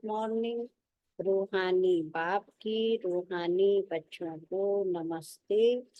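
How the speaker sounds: slow, measured devotional recitation; slow ritual greeting. Acted. Speaker reciting Baba's greeting to the spiritual children, slow and devout. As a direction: intone the blessing slowly.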